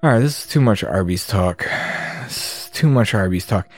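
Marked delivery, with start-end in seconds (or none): none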